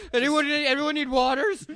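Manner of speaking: high, innocent-sounding mock excuse; flustered assistant. PA answering the director about the waters, mock flustered.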